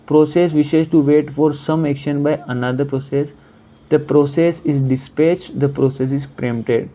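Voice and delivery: slowly reading each option out; slow read-aloud. Shown as a demonstration. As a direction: spell the options out at a crawl. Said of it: "teacher reads the options aloud".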